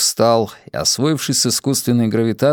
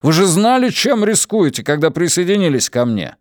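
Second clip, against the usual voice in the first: angry; 8 semitones higher than usual. A narrator's reading. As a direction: accuse him, voice rising high. Consul angrily accuses archaeologist.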